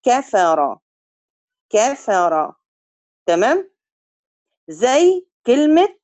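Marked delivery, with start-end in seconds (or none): 0.0-2.5 كفر with a short a; كفر, half-length a; half-length fatha. Shown as a demonstration. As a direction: say كفر clipped. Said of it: "أجيبها نص المدة".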